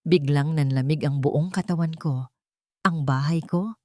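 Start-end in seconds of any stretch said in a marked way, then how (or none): none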